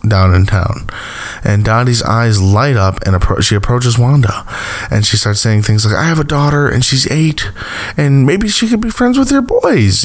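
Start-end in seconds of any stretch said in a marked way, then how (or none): 5.9-10.0 higher, lightly voiced pleading neighbor; a hint of a begging townswoman; mock pleading Dottie. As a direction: play her as a mildly desperate mother. Dottie pleading under Agatha's spell.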